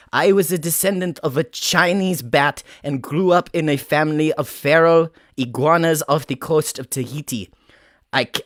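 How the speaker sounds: put-on comic Russian accent, wobbly but committed. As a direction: play a proud immigrant with a mock Russian accent. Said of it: "Russian, so I'm gonna work with that".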